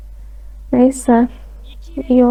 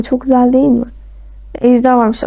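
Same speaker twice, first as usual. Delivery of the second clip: ordinary talk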